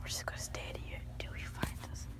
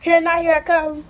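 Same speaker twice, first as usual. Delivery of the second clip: shouted seeker call; loud calling out; 2 semitones higher, 25 dB louder than usual. Real, not acted. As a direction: call out loud, here I come.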